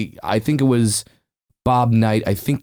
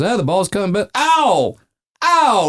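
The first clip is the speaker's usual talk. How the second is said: baseball announcer voice; 11 semitones higher than usual. Retold or imitated. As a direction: call your own injury like a broadcast. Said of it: "like in a baseball announcer voice".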